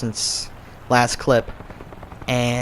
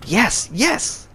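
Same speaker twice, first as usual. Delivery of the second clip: excited; 10 semitones higher than usual. Retold or imitated. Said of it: "and I'm like".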